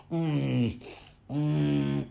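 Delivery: soft smacking kiss sounds on the hand; mock kiss, quiet mm sounds; hand-kissing noises to show the scene. Shown as a demonstration. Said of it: "I was just kissing my own hand".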